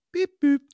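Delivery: high, loud electronic beep-boop; mimicked gadget chime; imitated device beep. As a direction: chirp two short high tones like a gadget. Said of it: "'Cause it, like, goes".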